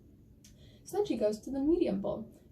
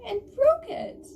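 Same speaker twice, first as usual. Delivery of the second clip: very high little bear, wailing louder at the end; littlest bear; 12 semitones higher, 5 dB louder than usual. Acted. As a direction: squeak the complaint up high, then cry out. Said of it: "And the littlest bear goes".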